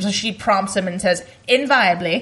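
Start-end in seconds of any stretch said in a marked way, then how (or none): 1.5-2.2 imitating the Queen's English accent; a light impression of the Queen's accent. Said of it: "I can say it in her accent".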